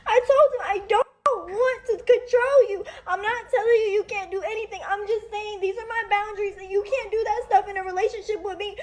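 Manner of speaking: talking through real tears; real crying. Real, not acted. Breaks down telling of abuse.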